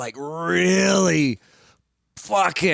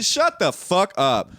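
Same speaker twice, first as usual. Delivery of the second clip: ordinary talk, nothing marked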